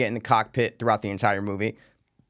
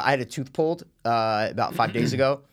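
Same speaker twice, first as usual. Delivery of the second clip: usual speaking voice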